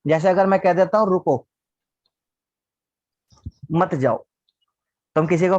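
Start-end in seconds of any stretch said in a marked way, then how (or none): 1.1-4.3 रुको, long pause, then lower मत जाओ; pause after रुको. Shown as a demonstration. Teacher showing what a comma does, long pause after रुको. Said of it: "लिखने में coma कर दिया ऐसा".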